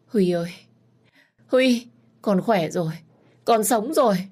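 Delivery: high, loud bursts of joy; joyful calling out. Acted. Mother cries out to her recovered son, overjoyed and loud. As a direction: cry out to him, overjoyed.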